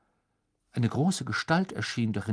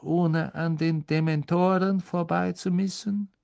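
sneering teacher; 7 semitones higher than usual. Acted. Snape interrogating Harry about the map. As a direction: needle him with each question.

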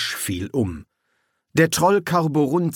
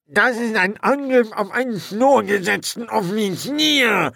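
high, muffled shrieking complaint; muffled screech; 10 semitones higher than usual. A narrator's reading. Corporal held by the troll, shrieking muffled and high. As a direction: shriek it from inside the troll's grip. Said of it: "das gedämpfte Gekeife".

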